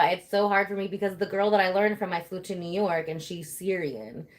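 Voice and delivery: usual speaking voice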